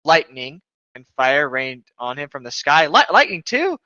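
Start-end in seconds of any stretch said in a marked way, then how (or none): 2.9-3.8 incredulous. Real, not acted. Reader balks at fanfic detail.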